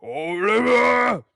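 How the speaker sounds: long, booming official summons; loud, drawn-out call meant to awe; impressive tone. A narrator's reading. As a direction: bellow the name slowly through a keyhole. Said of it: "said in a deep and impressive tone".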